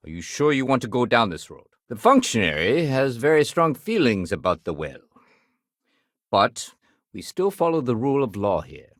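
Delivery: usual speaking voice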